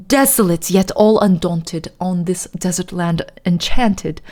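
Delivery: put-on dramatic poem recital in posh British accent; fake posh British accent. Streamer reciting The Raven for viewers, fake posh British accent. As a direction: declaim it in put-on posh British, rising then settling. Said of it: "my fake ass posh British accent".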